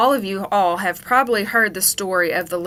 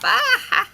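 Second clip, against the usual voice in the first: high mock laugh; hammed-up high laughter; laughing his head off. A narrator's reading. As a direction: giggle like a boy whose prank worked. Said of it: "just laughing his head off".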